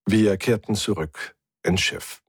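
plain reading voice

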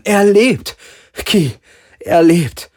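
high, loud elation; jubilant shout. A narrator's reading. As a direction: cheer the news out loud. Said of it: "jubelte Galen". (laut jubelnd)

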